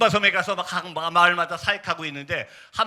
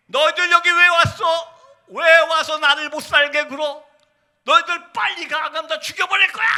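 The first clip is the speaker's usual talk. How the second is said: 귀신 들린 외침; 10 semitones higher, 5 dB louder than usual. Retold or imitated. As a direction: imitate a possessed woman's rising scream. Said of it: "막 소리 지르기 시작합니다". (귀신 들린 듯 높게 소리 지르기)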